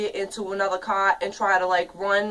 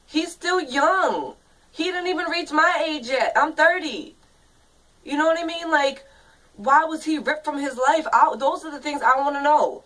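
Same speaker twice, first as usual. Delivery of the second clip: real anger; 5 semitones higher than usual. Real, not acted. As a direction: pitch rising with real frustration. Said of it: "And I'm angry".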